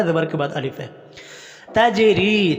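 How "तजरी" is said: तजरी with a high, long-held ee; lengthened raa. Shown as a demonstration. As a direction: stretch the ree of तजरी. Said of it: "रा को लंबा करेंगे". (तजरी में री को लंबा खींचकर)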